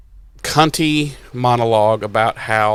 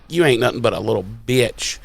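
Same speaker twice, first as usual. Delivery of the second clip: lower, quick, quiet taunt; cool low taunt; 4 semitones lower, 7 dB quieter, faster than usual. Retold or imitated. Wood taunting the First as he walks through, low and cool. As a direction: toss it off low and fast.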